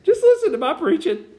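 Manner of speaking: very high, squeaking, with a laugh; squeaky teenager voice. Shown as a demonstration. Preacher joking about his squeaking voice, high and laughing. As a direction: push the voice up into a comic squeak. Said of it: "I sound like a thirteen-year-old".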